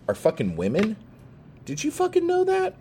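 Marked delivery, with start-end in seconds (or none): 1.6-2.7 incredulous taunt. Acted. Taunting the audience.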